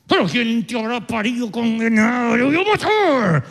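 exasperated parent blowing up, rising to a yell at the end; mock angry outburst. Fed-up parent exploding at the child, mock angry outburst. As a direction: act out a fed-up parent losing it at a kid.

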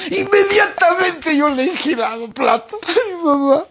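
hammed-up sobbing through the words; mock crying. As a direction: fake weeping for show. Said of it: "y todavía lloran".

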